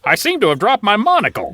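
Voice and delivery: quick, high snooty gentleman; lofty toff with a laugh; posh crab voice. Acted. Voicing highfalutin crab.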